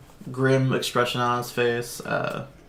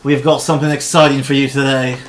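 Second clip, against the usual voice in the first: arena announcer; 2 semitones higher, 8 dB louder than usual. Acted. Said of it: "He goes".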